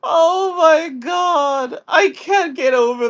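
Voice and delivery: surprised shout. Real, not acted. Guest reacts to fire story.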